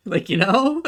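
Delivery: loud laugh breaking into the words. Real, not acted. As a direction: laugh at her own bluntness. Laughing after saying fuck you.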